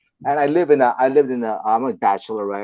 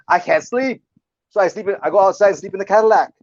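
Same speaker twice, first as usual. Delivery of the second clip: high, loud retold whine of protest; exasperated complaint; 9 semitones higher, 6 dB louder than usual. Friend explaining why he sleeps in the car, exasperated.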